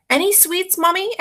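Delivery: put-on high, hopeful little-girl voice; little sister. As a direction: ask sweetly like a small girl. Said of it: "asks Margaret".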